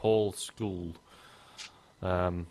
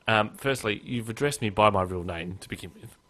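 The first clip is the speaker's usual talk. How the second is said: ordinary talk, nothing marked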